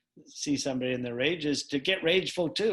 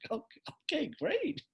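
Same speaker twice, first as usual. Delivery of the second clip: bright encouragement; 13 semitones higher, 5 dB quieter, much slower than usual. Retold or imitated. Upbeat facilitator reply.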